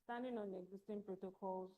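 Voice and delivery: barely audible, quick and very soft; too quiet. Real, not acted. Bio reader opening formally, too quiet to hear. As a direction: rush through it far too softly. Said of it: "Please speak up".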